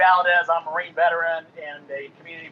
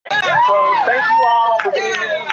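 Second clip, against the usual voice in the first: slow, much higher, loud shout stretched over the crowd; shouted thanks; 6 dB louder, much slower than usual. Real, not acted. Marine veteran shouting thanks over hecklers, loud and slow. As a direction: bellow it so the whole park hears.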